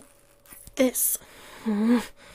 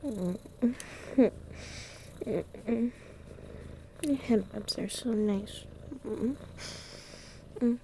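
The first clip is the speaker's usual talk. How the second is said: much softer, drowsy mms under the head rubs; soft contented murmurs; 12 dB quieter than usual. Acted. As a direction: melt into the petting, barely voiced.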